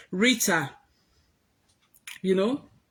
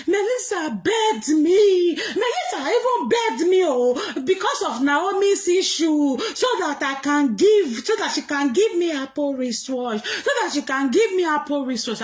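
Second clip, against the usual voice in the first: a put-on crybaby brag with a laugh in it; sneering retelling of her boast in a squeaky voice; mocking Lolo; 7 semitones higher, 4 dB louder than usual. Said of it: "Lolo said".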